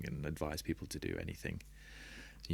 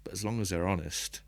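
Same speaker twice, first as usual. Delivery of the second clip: normal talking voice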